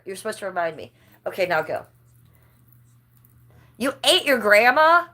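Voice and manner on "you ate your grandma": shocked. Real, not acted. Reacting to chat in shock.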